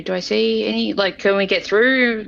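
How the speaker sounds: ordinary talk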